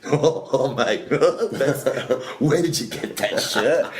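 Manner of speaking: high, laughing disbelief; delighted laughter. Retold or imitated. Man in wheelchair spotting the T-shirt, imitated laughing amazement. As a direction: act out cracking up, barely getting words out.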